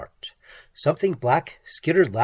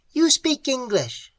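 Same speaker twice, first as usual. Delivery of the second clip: much higher, shrill creature voice; shrill clicking; 11 semitones higher than usual. Acted. Creature correcting Pat, shrill clicking voice.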